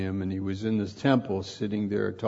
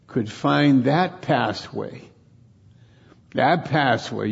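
usual speaking voice

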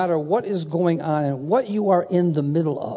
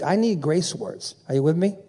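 usual speaking voice

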